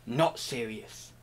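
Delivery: low, soft, slow "not serious". Shown as a demonstration. Contrasting serious and not serious, a low soft "not serious". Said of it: "I'm so serious about it that I'm not serious".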